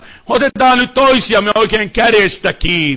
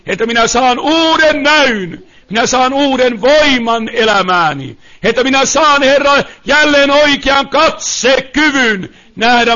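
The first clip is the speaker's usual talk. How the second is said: impassioned, sustained sermon delivery; fervent preaching; 5 semitones higher, 3 dB louder than usual. Real, not acted. Preacher urges listeners into God's hands, fervent preaching. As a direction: preach it insistently, driving each phrase home.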